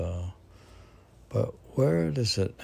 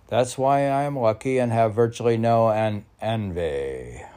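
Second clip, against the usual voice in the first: louder declaimed recital; poem reading; 5 dB louder than usual. Acted. Poet declaiming his poem to the host, proud recital voice. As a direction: proclaim the verse like a credo.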